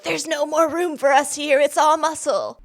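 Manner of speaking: high, loud, pretend panicked plea; desperate veins. Voicing veins begging to escape a body, mock-desperate. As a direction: cry out as comically trapped veins.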